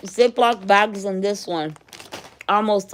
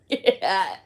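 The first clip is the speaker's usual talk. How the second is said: normal talking voice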